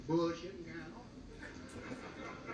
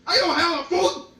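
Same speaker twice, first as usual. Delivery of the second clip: loud, higher put-on owner voice; mock restaurant owner; 7 semitones higher, 11 dB louder than usual. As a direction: protest as the owner.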